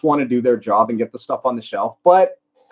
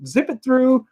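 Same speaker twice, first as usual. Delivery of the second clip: high, put-on voice of the nosy cashier; mock cashier quiz; 7 semitones higher than usual. Narrator mimicking the nosy thrift store cashier. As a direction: imitate the cashier who grills you. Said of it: "she's, like, going".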